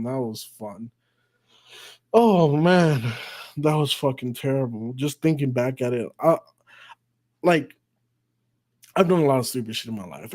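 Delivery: usual speaking voice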